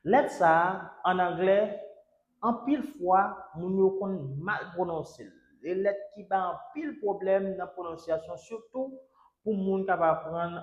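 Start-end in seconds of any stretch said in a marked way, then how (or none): none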